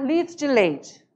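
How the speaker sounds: litro de leite run together as "lid'i leite"; mineiro clipped phrase. Shown as a demonstration. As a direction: blend litro de leite into one word. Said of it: "Bem juntinho".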